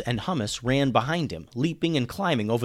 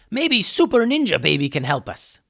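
high, put-on character yell; 6 semitones higher, 4 dB louder than usual. A narrator's reading. Hummus suggests the baby helps in battle, yelling. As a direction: call it out excitedly in a raised character voice. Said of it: "yelled Hummus".

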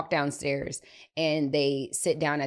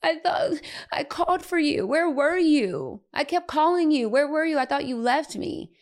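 put-on high, whiny wail of an abandoned little boy; tearful child; 12 semitones higher than usual. Eli confronts mom who was downstairs, tearful child voice. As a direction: imitate a small child's tearful reproach. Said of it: "he has tears in his eyes".

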